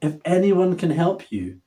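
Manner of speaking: usual speaking voice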